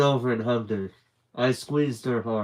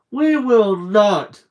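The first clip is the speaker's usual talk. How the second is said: high, loud and very slow, each word hammered; a boy yelling back at his brother, outraged; indignant shout; 9 semitones higher, 10 dB louder, much slower than usual. A narrator's reading. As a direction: stomp down hard on the refusal. Stevie contradicting his brother.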